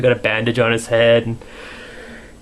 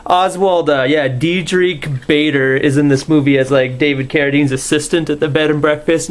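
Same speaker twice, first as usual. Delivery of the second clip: usual speaking voice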